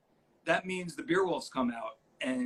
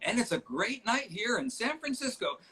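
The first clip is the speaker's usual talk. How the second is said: mock announcer; 11 semitones higher, slower than usual. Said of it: "she's trying to, like".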